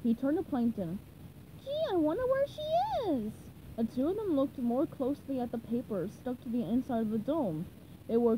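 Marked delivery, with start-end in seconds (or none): 1.6-3.3 very high, puzzled wondering; high-pitched puzzled character; cartoon sponge voice. A narrator's reading. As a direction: muse aloud in a squeaky register. SpongeBob wonders about Sandy.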